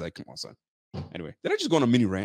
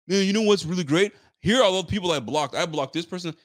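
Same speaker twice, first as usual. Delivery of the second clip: higher, smug mock voice; mocking smug poster; 7 semitones higher, 4 dB louder than usual. Mocking Twitter users announcing their blocks, smug high voice. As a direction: sneer it like a proud blocker.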